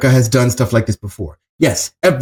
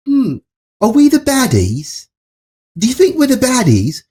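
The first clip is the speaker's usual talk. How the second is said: higher, mock-innocent musing; mock dawning doubt; 10 semitones higher than usual. Oblivious Americans finally asking about themselves, high mock wondering. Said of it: "That wasn't enough for people to be like".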